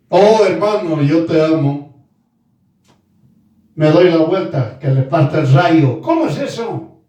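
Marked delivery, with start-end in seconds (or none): 0.1-2.1 low, put-on pious affection; mock a falsely loving churchgoer in a lowered voice; lowered mock-devout voice; hypocritical loving brother. Mimics hypocrite professing love to brother. 3.7-6.0 low, mock aside behind someone's back; a hint of a two-faced believer, pitched low; two-faced hypocrite's curse.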